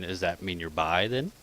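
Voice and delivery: ordinary talk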